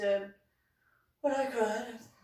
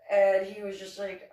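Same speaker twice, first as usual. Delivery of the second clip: ordinary delivery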